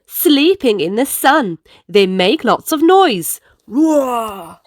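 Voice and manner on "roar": a light growling roar; tiger roar. Acted. Animals making noise.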